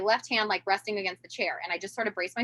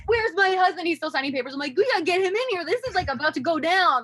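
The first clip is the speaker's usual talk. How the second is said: higher, louder urgent calls; mother calling for husband, baby nearly here; urgent alarm; 8 semitones higher, 4 dB louder than usual. Retold or imitated. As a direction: panic to get him in now.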